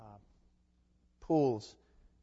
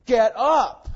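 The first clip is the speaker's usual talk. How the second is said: sharp, much louder and higher order; commanding shout; 9 semitones higher, 15 dB louder than usual. Shown as a demonstration. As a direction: bark it as a command that cannot be refused. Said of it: "It's an imperative".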